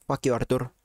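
ordinary talk, nothing marked

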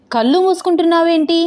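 incredulous question. Acted. Instructor asks why she shuts her eyes riding, incredulous. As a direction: question her in teasing disbelief.

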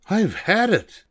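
high, exasperated mother's cry; Mama throwing up her hands; fed-up Mama. Acted. Mama giving up on the old car, high and exasperated.

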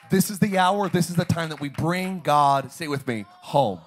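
loud, driving repeated preaching lines; full-force preaching. Acted. Pastor preaching to the church, loud and driving. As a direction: hammer each line home to the congregation.

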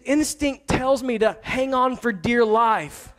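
slow, deliberate quoting of the daughter; daughter's words, slowed. Retold or imitated. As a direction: lay out each word slowly, like quoting a child's excuse. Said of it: "She said".

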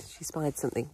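ordinary talk

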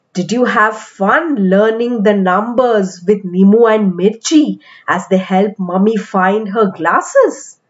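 usual speaking voice

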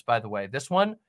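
ordinary talk, nothing marked

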